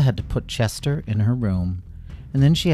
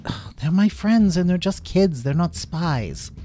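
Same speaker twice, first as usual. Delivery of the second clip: much higher, frustrated plea; exasperated protest; 9 semitones higher than usual. A narrator's reading. Lila protesting the guards taking her friends. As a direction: object in exasperation.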